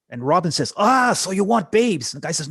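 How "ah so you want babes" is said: higher, louder, slow teasing drawl. Retold or imitated. Robbins teasing the guy about wanting babes. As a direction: draw it out with a knowing tease.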